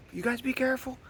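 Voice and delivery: high, mock-nervous warning. Kayakers met on the water warn the vlogger, in a high mock-nervous voice. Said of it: "And they're kinda nervous".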